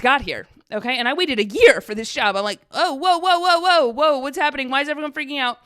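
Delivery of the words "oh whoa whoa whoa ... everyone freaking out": high, louder startled whoa whoa; mock alarm as everyone freaks out. Retelling coworkers freaking out, startled higher whoa. Panicked reaction to office freakout.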